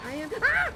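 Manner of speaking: sudden high loud yelp of fright; real scared yelp; startled scream. Real, not acted. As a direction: shriek at the jump scare. Jump scare in game.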